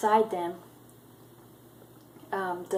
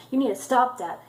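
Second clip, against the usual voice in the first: higher and louder, a sharp reproach; heated demand; 5 semitones higher, 6 dB louder, faster than usual. Retold or imitated. Clary scolds Jace after the fight, higher and louder. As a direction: push back at him hard.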